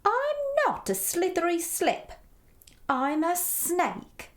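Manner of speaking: high-pitched storybook snake voice; hissing snake. A narrator's reading. Snake corrects Edward about its name, hissing. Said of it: "hissed the snake".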